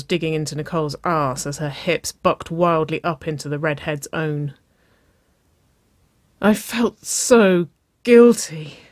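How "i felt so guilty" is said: slow, higher, breathy moan; louder, drawn-out moaned confession. A narrator's reading. Waverley confessing her guilt to Nicole, slowly moaning. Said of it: "she moaned".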